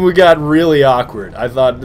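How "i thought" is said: normal talking voice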